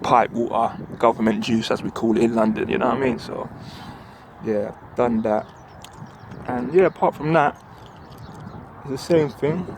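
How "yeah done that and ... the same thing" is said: talking while chewing gum; words around a wad of gum. Real, not acted. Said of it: "I'm chewing gum".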